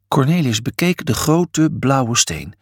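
ordinary delivery

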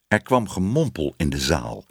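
ordinary delivery, nothing marked

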